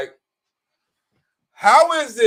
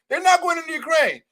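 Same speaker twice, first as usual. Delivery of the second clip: a much higher, loose parody of Trump's line; mock Trump; 9 semitones higher than usual. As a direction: toss it off like a carefree Trump. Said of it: "You hear the difference?".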